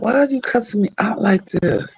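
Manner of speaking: high innocent voice. Retold or imitated. Retelling the fight, innocently asking why. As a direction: squeak it out like the wronged one. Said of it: "I was like".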